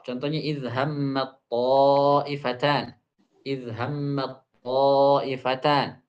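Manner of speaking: izhammat ta'ifatan with merged heavy t; slowly, ta'ifatan heavy; slow model reading. Shown as a demonstration. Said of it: "slow model reading".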